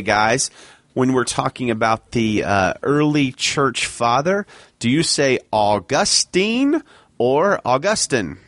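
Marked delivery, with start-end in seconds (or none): 4.8-8.4 put-on "Augustine" first as AW-gus-teen, then as a-GUS-tin; two ways to say Augustine. Shown as a demonstration. Host quizzing friends on saying "Augustine" two ways. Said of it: "Which one do you say".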